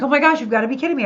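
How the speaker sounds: high, fast, excited gasp of disbelief. Retold or imitated. Reading a long-lost friend's text, thrilled and disbelieving. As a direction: rattle it off in happy shock. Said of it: "And I was like".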